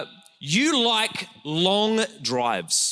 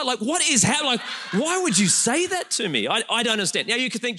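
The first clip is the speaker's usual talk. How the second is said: normal talking voice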